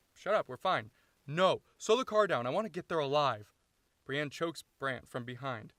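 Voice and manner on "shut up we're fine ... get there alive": loud, raised-pitch shouting match; heated argument. Acted. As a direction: yell back and forth in a car quarrel. Acted shouted quarrel in car.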